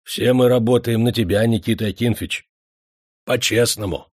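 measured pride of an honest worker; dignified. A narrator's reading. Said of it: "закончил с достоинством".

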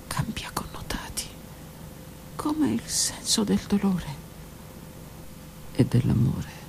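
plain reading voice

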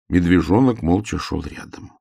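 ordinary delivery, nothing marked

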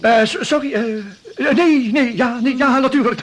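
high, loud flustered backpedalling; flustered stammer. Acted. Salesman caught out when she wants her coffee, flustered. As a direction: trip over your words in a panic.

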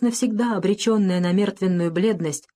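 ordinary delivery, nothing marked

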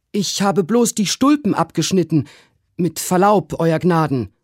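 high-pitched deferential servant; servant Trim protesting. A narrator's reading. Servant defends cutting the boots, high and deferential. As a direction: protest respectfully in a high, altered voice. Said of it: "rief Trim".

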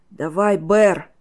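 loud, sharp urging; forceful, pained command; barked order. A narrator's reading. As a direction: bark it at the helper. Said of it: "гаркнул Тейп".

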